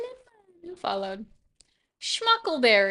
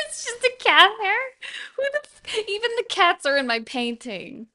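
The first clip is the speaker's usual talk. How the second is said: amused exasperation; 10 semitones higher than usual. Real, not acted. Finds cat hair, exasperated.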